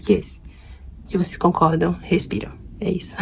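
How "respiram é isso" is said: playful whisper. Real, not acted. Asking viewers to breathe if they agree, whispered. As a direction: drop to a secret whisper.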